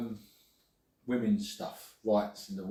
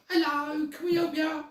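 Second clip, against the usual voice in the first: much higher, chirpy put-on waitress voice; high sing-song server greeting to new guests; mock waitress greeting; slower than usual. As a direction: imitate the cheery restaurant greeter. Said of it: "Without their silly accent".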